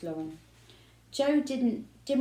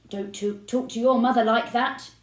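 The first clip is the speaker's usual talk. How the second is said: loud, raised telling-off; shout; 4 semitones higher, 7 dB louder than usual. A narrator's reading. Father shouting at his son for rudeness. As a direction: scold the boy loudly. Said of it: "shouted Mister Spud".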